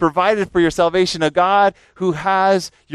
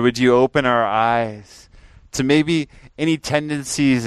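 closing prayer; 4 semitones lower, slower than usual. Real, not acted. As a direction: pray it low and slow. Closing prayer over music.

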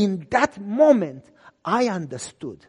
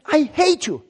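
louder, higher snarl of hate; hateful retort; 7 semitones higher, 6 dB louder than usual. Retold or imitated. Dying persecutor spitting hatred at the pastor. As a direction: spit it out at the pastor.

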